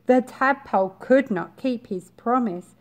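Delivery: ordinary delivery, nothing marked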